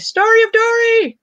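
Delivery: high, loud sing-out of the name; excited call-out. Real, not acted. Host greeting a viewer in the chat, excited call-out.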